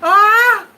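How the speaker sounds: loud high "Ah!" of shock; shocked shriek. Real, not acted. Spotting the Versace logo, loud shocked yelp. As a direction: yelp like you've been stung.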